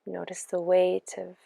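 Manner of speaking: unhurried meditation voice with long pauses; guided meditation. Acted. Yoga teacher easing listeners into their breath and body, guided meditation. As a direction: guide them gently into rest, phrase by phrase. Leads guided relaxation practice.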